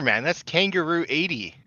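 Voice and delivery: ordinary talk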